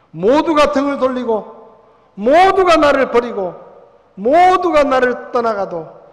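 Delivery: loud, ringing preacher voice; preaching at full force. Acted. Pastor to his congregation, loud fervent preaching. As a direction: proclaim it to the whole hall.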